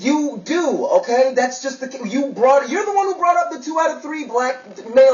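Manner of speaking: high, loud, exasperated accusation; heated argument. Acted. Man throwing his date's statistic back at her, heated. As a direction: argue back at full pitch. Heated lovers' quarrel over race.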